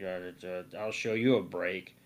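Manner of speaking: a hint of a low beast voice; defiant beast.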